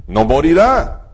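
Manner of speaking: ordinary talk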